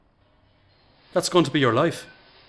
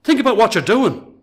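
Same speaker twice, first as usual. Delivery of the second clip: rising to a loud, pitched-up rebuke; angry outburst; 5 semitones higher, 4 dB louder than usual. Acted. Jim shouting at Bobby to stop lying for Breno, angry. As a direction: Jim lets his frustration burst out at Bobby.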